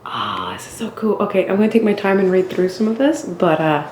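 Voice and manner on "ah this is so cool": slow, very soft whisper of wonder; awed whisper. Real, not acted. Exploring another old building, slow hushed whisper. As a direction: barely breathe the words in awe.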